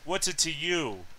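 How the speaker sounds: lightly voiced gruff woman, drawn out; gruff female voice. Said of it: "more of a gruff tone to it".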